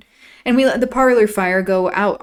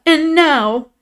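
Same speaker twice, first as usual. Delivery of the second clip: tearful; 9 semitones higher, 7 dB louder than usual. A narrator's reading. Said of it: "sobbed Isabella".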